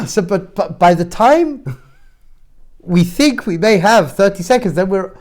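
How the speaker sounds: playing up overwhelmed exasperation; frantic parent. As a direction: punch it out, worn ragged by the rush. Continues acted morning rush.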